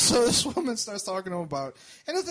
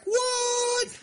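shocked what. Retold or imitated. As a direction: wail it in mock disbelief. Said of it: "And I'm like".

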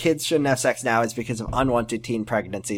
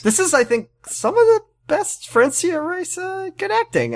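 high, slow sarcastic gushing; sarcastic praise. Acted. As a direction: drip irony over the praise. Ironic praise.

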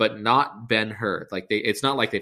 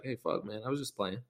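sheepish backing down; 12 dB quieter than usual. Retold or imitated. Guard backing down before Jesus.

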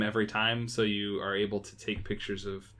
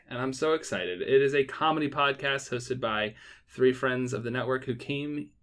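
normal talking voice

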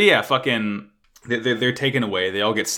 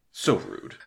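"So rude" falling low in deadpan offense; mock offended, falling; 4 semitones lower, 6 dB quieter than usual. Shown as a demonstration. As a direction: drop "So rude" flat and low.

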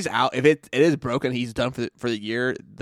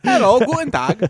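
mock German; 11 semitones higher, 8 dB louder than usual.

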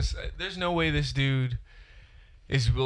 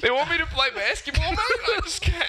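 put-on much higher, laughing, whiny Australian accent; a mock sobbing Aussie wailing about basketball; Ben Simmons as Aussie; slower than usual. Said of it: "You sound".

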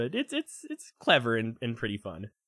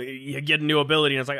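ordinary delivery

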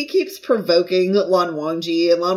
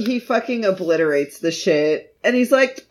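ordinary talk

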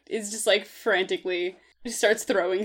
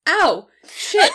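sharp high yelp of pain; 9 semitones higher, 9 dB louder than usual. Acted. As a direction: yelp as the acid burns. Burned by spilled acid.

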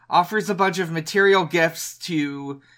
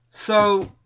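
ordinary talk

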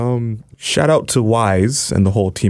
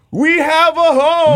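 high, drawn-out cheer; triumphant holler; excited announcement; 5 dB louder, slower than usual. Real, not acted. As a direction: belt out the big news, high and long.

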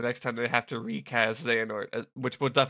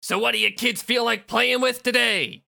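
taunting villain; 10 semitones higher, 4 dB louder than usual. Acted.

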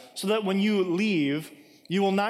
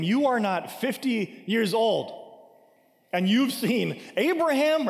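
slow, higher-pitched mocking disbelief; scoffing, climbing higher on the final question; 5 semitones higher, slower than usual. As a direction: draw out the incredulous question to mock him. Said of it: "they're just mocking him".

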